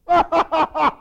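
loud, high mocking cackle; hammed-up taunting ha-ha; jeering laugh. Mocking laugh after the challenge.

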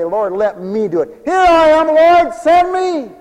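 rising to a high, loud, slow cry; fervent prayer. Acted. Preacher answering the Lord's call, crying out fervently. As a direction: cry out to the Lord, offering yourself. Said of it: "I feel the Lord call me and I say".

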